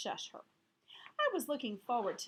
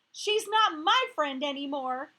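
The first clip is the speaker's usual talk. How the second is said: crying angry; 4 semitones higher, 12 dB louder than usual. Acted. Gail crying.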